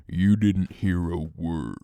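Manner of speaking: a hint of a mobster warning; low, slow mock threat to the ride attendant; mock menacing. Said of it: "I just look at her and I go".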